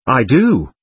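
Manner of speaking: usual speaking voice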